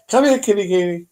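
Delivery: mock cooing at a cat. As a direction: coax like calling a kitty, mocking. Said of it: "it's like".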